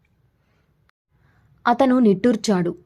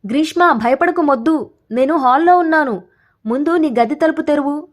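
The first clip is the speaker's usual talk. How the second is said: higher, louder call through a closed door; shouting from outside; 6 semitones higher, 4 dB louder than usual. A narrator's reading. Friend outside the door shouts to reassure her, loudly. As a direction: raise the voice to carry through the door. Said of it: "అని బయట్నుంచి గట్టిగా అరిచాడు".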